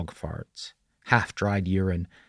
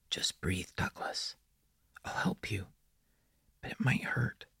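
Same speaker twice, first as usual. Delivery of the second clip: put-on very soft, whispered child voice; prepubescent boy; 10 dB quieter than usual. A narrator's reading. The boy warns Douglas it may hurt, in a childlike whisper. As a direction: whisper it barely aloud, like an eerie boy. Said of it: "his phlegmy, prepubescent voice".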